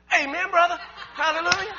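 high, loud church-style exclamation; jubilant shout. Retold or imitated. Son answering his father's strange message, jubilant shout.

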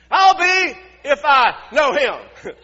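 slow, high, full-volume denial; shouted curse. Acted. Peter curses himself denying Jesus, slow high shout. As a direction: stretch out a hammed-up oath, shouting. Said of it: "began to call down curses on himself".

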